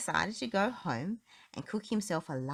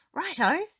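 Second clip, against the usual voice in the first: much higher, louder chirpy "Righto"; Mister Muddle; 11 semitones higher, 6 dB louder than usual. A narrator's reading. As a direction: sing out a high daft "Righto". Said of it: "said Mister Muddle".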